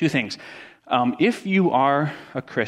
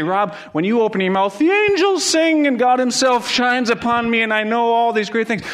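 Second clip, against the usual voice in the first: high, gushing and louder, hammed-up adoring fan; gushing mock praise; 9 semitones higher, 4 dB louder than usual. Preacher voices people praising him, in gushing mock praise. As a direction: lay the flattery on thick, tongue in cheek. Imagined flattery from the congregation.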